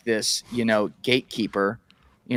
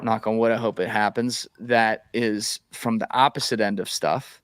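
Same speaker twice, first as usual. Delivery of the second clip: ordinary talk, nothing marked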